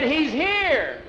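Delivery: high, insistent cry; high-pitched yell; screaming. A narrator's reading. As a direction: call it out high and excited. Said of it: "had heard Skeeter screaming".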